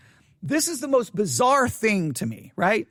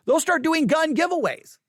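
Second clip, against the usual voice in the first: ordinary delivery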